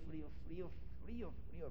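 soft, fading repeat of the word; faint shiver. Shown as a demonstration. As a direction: let the cold creep into the voice. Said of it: "empiezas a sentir frío". (susurro de frío)

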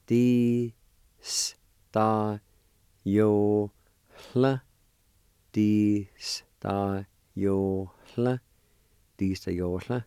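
Di-sta-yo-hla said clearly, syllable by syllable; pronounced slowly. Shown as a demonstration. Teacher modelling Di-sta-yo-hla for students. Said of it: "this pronounced like this".

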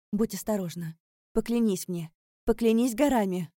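building from firm warning to loud demand; urgent plea. Acted. Urgent parting plea for an oath.

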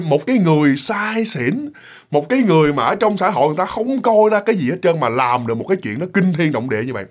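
ordinary talk

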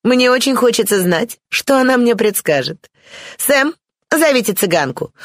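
put-on high-pitched haughty young lady; lofty, self-willed voice, pitched well up; Бланш. A narrator's reading. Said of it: "раздался надменный голос Бланш". (высокий надменный голос барышни)

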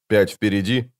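ordinary delivery, nothing marked

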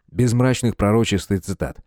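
plain reading voice

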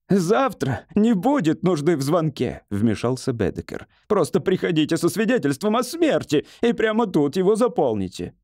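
high-pitched bitter interruption; ranting hypochondriac. Acted. Patient tells doctor not to bother calling, bitter. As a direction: cut in sourly, pitched high.

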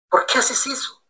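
soft, hushed whisper-like ask; hushed question. Retold or imitated. Friend Rosita asking quietly in church, hushed question. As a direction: ask it under your breath.

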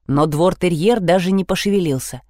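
usual speaking voice

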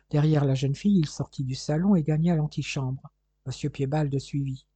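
usual speaking voice

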